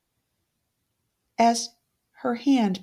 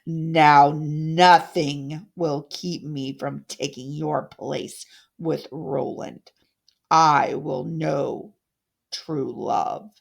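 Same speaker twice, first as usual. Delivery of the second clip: low, slow, triumphant witch voice; gloating evil stepmother; 6 semitones lower, slower than usual. Acted. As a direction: savour every word of her victory. Odette gloats over her victim.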